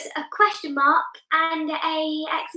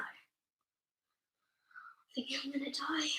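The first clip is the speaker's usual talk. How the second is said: soft, breath-only whisper; whispered aside; 14 dB quieter, faster than usual. Real, not acted. Child muttering while waiting for scores, whispered aside. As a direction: whisper it under your breath.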